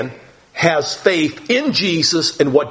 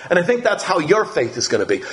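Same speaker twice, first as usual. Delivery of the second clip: ordinary talk, nothing marked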